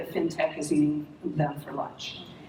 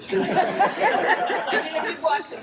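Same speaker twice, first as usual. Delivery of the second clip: higher, louder words broken by laughter; laughing while talking; 9 semitones higher, 6 dB louder, slower than usual. Real, not acted. Still laughing at the kids' soccer story, talking through laughter. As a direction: talk through the giggles.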